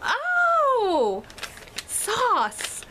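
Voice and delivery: high, drawn-out mock "oh" of understanding; exaggerated high "ohhh" as it clicks; sudden realization. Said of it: "I was like".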